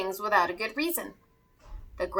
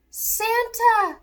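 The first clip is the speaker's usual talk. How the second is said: high, louder elf cry; 10 semitones higher, 5 dB louder than usual. Acted. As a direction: call out in alarm as the little elf. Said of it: "cried Eddie".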